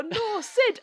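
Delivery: high, loud mock-panicked cry; desperate warning shout. Storyteller too late to save Sid, desperate acted shout. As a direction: yell it as disaster strikes.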